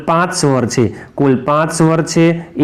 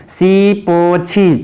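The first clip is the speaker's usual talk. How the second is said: very slow, drawn-out syllables; slow dictation; much slower than usual. Shown as a demonstration. Teacher spelling out the C rule, very slow and stretched. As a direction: stretch each word of the rule.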